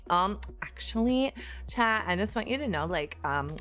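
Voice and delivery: put-on smarter Valley Girl. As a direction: correct the chat as a would-be smart Valley Girl. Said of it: "gonna have to do a Valley Girl".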